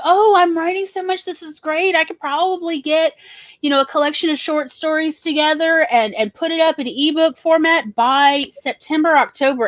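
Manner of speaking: gushing over how much you wrote; excited past self. Retold or imitated. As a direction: play your naive, thrilled past self. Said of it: "I was like".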